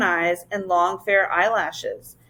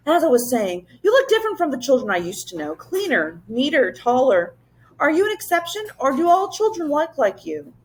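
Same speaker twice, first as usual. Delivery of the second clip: a higher, quick, curious beast voice; curious Wangdoodle; 6 semitones higher, faster than usual. A narrator's reading. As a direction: size up the children eagerly. Wangdoodle questions children.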